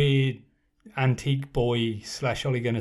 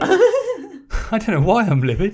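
laughing; 8 semitones higher, 7 dB louder than usual. Real, not acted. Laughing at own mock outrage.

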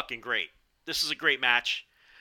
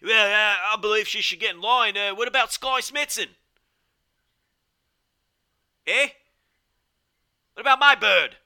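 higher, louder mock British commentator; British Gideon Gray impression; 7 semitones higher, 6 dB louder than usual. British commentator scoffing at a title contender, higher, louder mock British accent. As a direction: put on a British accent, pitched up and loud, scoffing. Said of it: "it was more like this".

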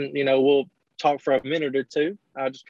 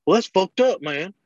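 higher, whiny retold complaint; a hint of a sulking fan, pitched up; mock offended fan; 6 semitones higher than usual. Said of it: "he was just like".

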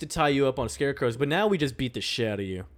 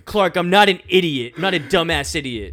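loud, offended protest; mock indignation; 3 semitones higher, 8 dB louder than usual. [name] protesting to Clark, in loud mock offense. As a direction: bark it back, hurt pride.

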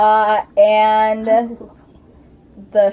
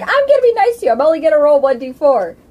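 mock sweet promise; 5 semitones higher, 5 dB louder, much faster than usual. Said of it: "I was like".

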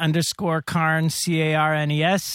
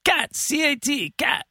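high mock old lady; 10 semitones higher than usual. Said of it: "She's like".

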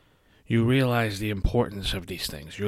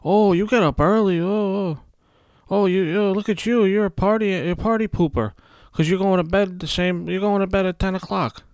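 high, louder sing-song taunting; making fun; 10 semitones higher, 5 dB louder than usual. Retold or imitated. Night owls making fun of a party pooper, high taunting. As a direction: taunt them like a schoolyard bully. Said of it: "They make fun of you".

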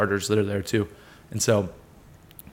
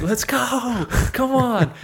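celebratory cheer; 12 semitones higher, much slower than usual. Retold or imitated. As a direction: cheer in triumph, holding the volume back. Retells celebrating pin with coach.

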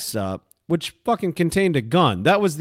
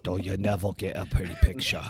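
low, soft movie-star voice breaking into laughter; laughing Crowe impression; 6 semitones lower, 6 dB quieter than usual. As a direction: try the gruff line but crack up. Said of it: "To quote Russell Crowe in Proof of Life".

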